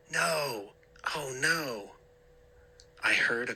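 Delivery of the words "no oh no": dismayed. A narrator's reading. Narrator sees vase shatter.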